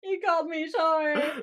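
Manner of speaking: put-on slow, high sulky drunk; mock drunken wail. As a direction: imitate a tearful drunk's complaint. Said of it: "You'd think he was drunk".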